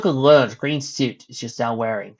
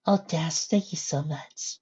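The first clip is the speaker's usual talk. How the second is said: grateful, soft; 4 semitones higher, 7 dB quieter, slower than usual. Acted.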